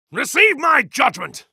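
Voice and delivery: triumphant god shouting; shouted judgment. Acted. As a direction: bellow it as you strike. Attack shout in battle.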